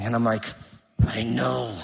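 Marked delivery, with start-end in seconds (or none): 1.1-1.7 low, soft, strained reply; holding back. Retold or imitated. Son avoiding an argument with dad, holding back. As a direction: keep it small and bitten back. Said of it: "bite my tongue".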